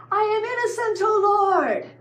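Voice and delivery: high, whining, self-pitying plea; mock whiny protest. Teacher mocks young David pleading innocence to God, high whiny plea. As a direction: whine it like a wronged child.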